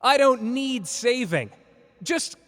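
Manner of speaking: loud, high, indignant shout; angry outburst. Acted. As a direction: snap at them for playing the hero. Scolding the one who jumped in to save him.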